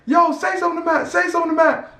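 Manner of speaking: high put-on hype voice; fan urging. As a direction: egg the crowd on, voice pitched high. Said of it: "he was like".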